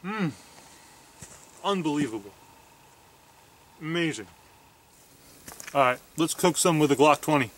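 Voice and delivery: chewing while speaking; muffled by food in mouth; mouth full of food. Real, not acted. Seen 3: Bites at -2/-1, then chews with closed lips during the words.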